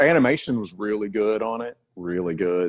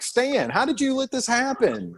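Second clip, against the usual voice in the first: high-pitched mock reproach; dismayed, hammed-up appeal, pitched way up; incredulous pleading; 14 semitones higher than usual. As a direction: plead with an absent culprit in disbelief.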